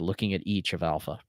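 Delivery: plain reading voice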